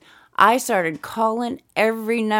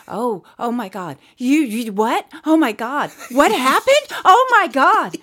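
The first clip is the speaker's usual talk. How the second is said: much higher, frantic manager voice; flustered manager; 8 semitones higher than usual. Retold or imitated. Manager on the phone begging Regina to wait, frantic. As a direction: stall her in a panic.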